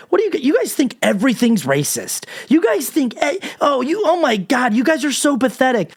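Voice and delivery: high, whiny sneering voice; mocking conservatives. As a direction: parrot them back in a high whine. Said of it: "conservatives were there to try to gaslight".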